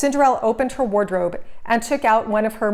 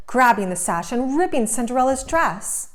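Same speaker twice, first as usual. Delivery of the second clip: ordinary delivery, nothing marked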